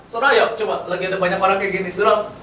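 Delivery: ordinary talk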